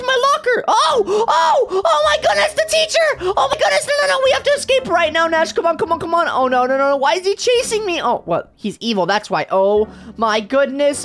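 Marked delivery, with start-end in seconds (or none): 0.0-8.0 high panicked shouting; panicking gamer. Real, not acted. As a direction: scream in fright, gasping. Teacher appears and chases her.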